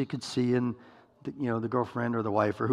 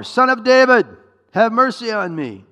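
very loud, high plea; crying out harder; 11 semitones higher, 12 dB louder than usual. Acted. Beggar refusing to be silenced, crying out all the more. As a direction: call out all the more, at full voice. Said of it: "he kept crying out all the more".

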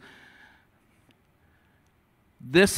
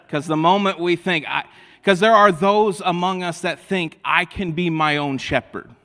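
rising, louder preaching, pressing the point; preaching at full force; 4 semitones higher, 5 dB louder than usual. Acted. Preacher challenging the proud congregation, louder. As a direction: preach it hard to the room.

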